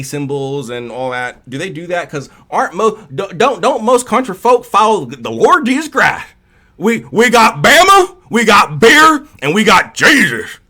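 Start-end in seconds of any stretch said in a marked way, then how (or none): 2.1-10.6 high, loud hammed-up Southern holler; mock Southern accent. Streamer mocking country folk's faith, loud Southern accent. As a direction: caricature a fired-up Southerner. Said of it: "make fun of people from the South".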